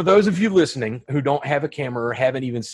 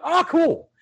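impressed; 14 semitones higher than usual. Retold or imitated. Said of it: "I'd be like".

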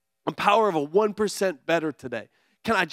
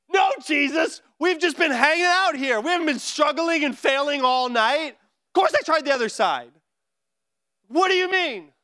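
higher, put-upon, exasperated retort; exasperated disciple; 7 semitones higher than usual. Retold or imitated. Fisherman answering Jesus, mock exasperated. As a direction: play the fed-up fisherman snapping back. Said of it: "I would be frustrated".

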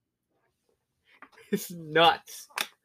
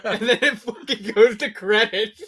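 higher, louder, slowed by laughter; laughing punchline; 6 semitones higher, 6 dB louder than usual. Real, not acted. Friend telling a cinema story, laughing. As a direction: laugh your way through the punchline.

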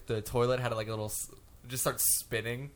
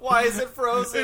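mock panic; 10 semitones higher, 8 dB louder than usual. Acting astronauts in a mess.

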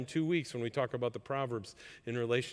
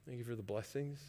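softly voiced thanks to God; soft, humble prayer of thanks; quiet thankful prayer; 10 dB quieter than usual. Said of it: "go to the Lord with that emotion and say".

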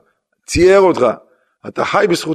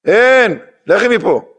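higher-pitched mock rebuff; imitated curt dismissal; 7 semitones higher, slower than usual. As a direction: act out a storekeeper sending her away. Storekeeper throws out begging mother. (חיקוי של סירוב כועס)

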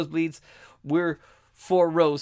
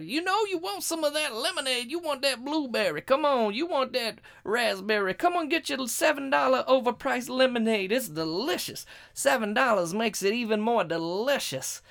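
mock stadium vendor pitch, much higher; hollering vendor; 7 semitones higher than usual. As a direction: hawk overpriced lemonade up the stands. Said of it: "He's hollering out".

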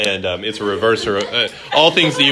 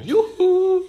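higher, lilting mock shopkeeper call; shopkeeper sing-song. Teacher quoting the Frozen shopkeeper to his class, sing-song. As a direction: sing it out like a cheery salesman. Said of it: "anytime we drive by somewhere".

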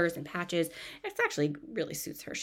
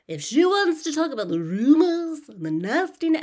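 higher, louder mock English accent; imitating her odd accent; weird put-on accent; 10 semitones higher, 8 dB louder than usual. Host mocking Meredith's weird accent at dinner. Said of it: "the weird accent".

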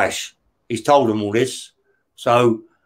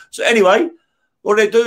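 usual speaking voice

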